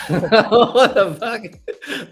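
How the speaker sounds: laughing. Real, not acted. Laughing at a surprise accent.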